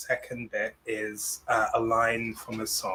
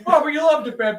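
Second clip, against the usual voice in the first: high, loud diva tone; 14 semitones higher, 7 dB louder, faster than usual. Acted. Cher comforting her husband's ghost.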